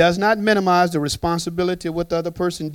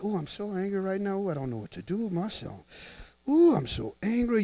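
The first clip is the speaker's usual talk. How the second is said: mock angry person; 2 semitones higher, 9 dB quieter than usual. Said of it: "You ever heard somebody".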